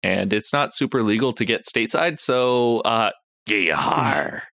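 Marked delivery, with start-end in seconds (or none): 3.5-4.4 lightly voiced pirate growl of "yar". Co-host jokes about piracy, mock pirate. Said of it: "Go be pirates".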